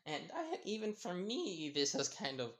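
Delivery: high stammering panic with an awkward laugh; nervous stammer. Acted. Mina pleading with Jiro, nervous stammering. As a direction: stutter out an excuse, sweating bullets. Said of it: "sweating bullets".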